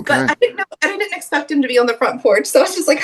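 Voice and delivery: usual speaking voice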